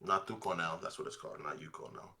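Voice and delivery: low, quiet muttering to oneself; mumbling. Real, not acted. Streamer murmuring odd names to himself, mumbling. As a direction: trail off into a soft mumble. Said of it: "what are you mumbling about?".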